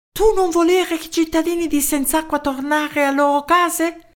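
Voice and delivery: high-pitched astonished question; puzzled foreign professor. A narrator's reading. Professor questioning the mayor's words, high surprised foreign voice.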